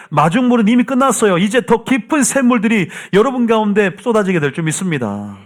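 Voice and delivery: high-pitched, driving proclamation; fervent preaching. Real, not acted. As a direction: declare it to the congregation with mounting fervor. Preaching at rising force.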